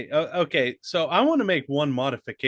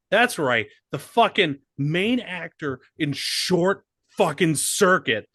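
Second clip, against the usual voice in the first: ordinary talk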